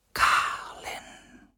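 eerie unvoiced whisper of the shadow; ghostly longing whisper; whispered name. A narrator's reading. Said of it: "flüsterte er".

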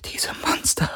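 frightened whisper. Acted. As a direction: whisper it in shock. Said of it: "flüsterte sie".

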